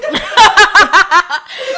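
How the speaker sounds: loud, high laughter bursting out. Real, not acted. Laughing hard at her friend's story. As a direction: crack up loud at the joke.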